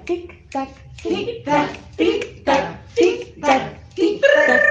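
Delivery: high, clipped tic tac; ticking clock. Acted. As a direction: tick off the seconds like a clock. Clock ticking before telenovela.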